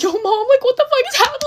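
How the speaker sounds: very high, squealed mock-panic wail; high-pitched hammed-up panic; panicked crying call. Acting panicked call to mom.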